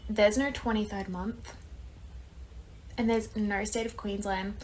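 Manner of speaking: quiet, know-it-all mock correction; gently smug, as if sure she is right. Manager wrongly correcting the Australian ID, quiet smug tone. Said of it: "She was like".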